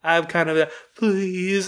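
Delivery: high, awkward hesitant owner; hammed-up reluctant stranger; mock awkward refusal.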